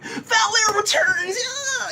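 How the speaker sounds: high, drawn-out hype scream; excited announcer yell. Acted. Fan shouting the hero's return from his notes, excited high scream. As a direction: stretch it out in a high, screaming cheer.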